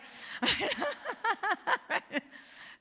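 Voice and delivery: real peal of high-pitched laughter; long high cackle. Real, not acted. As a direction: cackle at your own joke, high and long. Laughs at own imitation.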